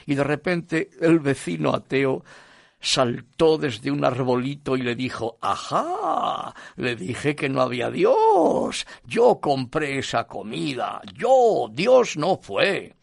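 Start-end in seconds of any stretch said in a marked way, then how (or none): 5.4-12.9 loud, drawn-out gloating; triumphant gotcha. Acted. Atheist jumps out at his neighbour, gloating triumphantly. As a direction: crow it slowly, leaping up in pitch.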